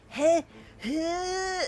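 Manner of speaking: mock heh-heh sneeze wind-up; warm-up sneeze breaths. Shown as a demonstration. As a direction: demonstrate the build-up before a sneeze. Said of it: "You could do, like, a".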